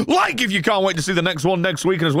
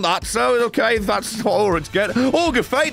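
normal talking voice

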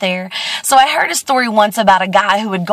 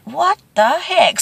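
puzzled thought; 8 semitones higher, slower than usual. Acted. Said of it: "The guy thought".

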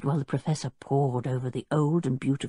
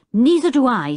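firm, louder and higher; decided; 10 semitones higher, 8 dB louder than usual. A narrator's reading. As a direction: say it with no room for doubt. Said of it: "in a most decided voice".

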